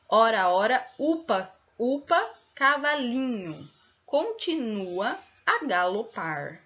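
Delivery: verse with strong beats on O in "ora", U in "upa", LI in "cavalinho"; stressed tonic syllables. Shown as a demonstration. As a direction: punch each stressed syllable, stretching "continua a galopar". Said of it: "Que tem mais força". ("ora, upa, cavalinho" com as sílabas fortes bem marcadas)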